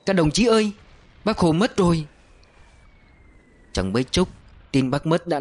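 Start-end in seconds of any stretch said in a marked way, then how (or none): 0.1-2.1 crying out. A narrator's reading. Soldier running in the rain shouting the news, high wail. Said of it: "vừa chạy vừa gào".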